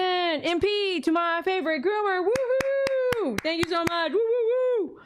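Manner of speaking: high whooping thanks; cheering. Real, not acted. Seller cheering a donor's payment, high excited whoops.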